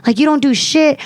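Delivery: high-pitched scornful jab; indignant retelling, without shouting; retold trash talk. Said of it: "I'm talking shit to, to all the guys".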